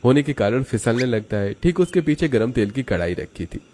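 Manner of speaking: usual speaking voice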